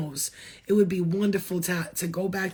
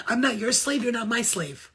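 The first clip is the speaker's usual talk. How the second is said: ordinary talk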